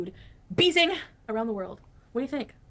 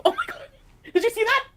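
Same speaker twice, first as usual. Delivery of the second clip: excited; 12 semitones higher, 5 dB louder than usual. Real, not acted. Reacting to sign.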